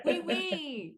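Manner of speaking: high, hammed-up French accent through laughter; badly faked French "oui"; terrible French accent. Shown as a demonstration. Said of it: "did you do it in a French accent?".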